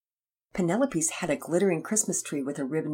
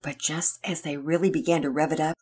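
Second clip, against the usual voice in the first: usual speaking voice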